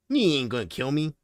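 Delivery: ordinary talk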